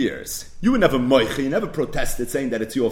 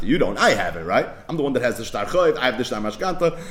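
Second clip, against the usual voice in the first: ordinary delivery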